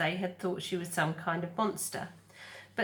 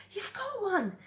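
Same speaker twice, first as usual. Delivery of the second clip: high gosling voice. Acted. Gosling voice in read story.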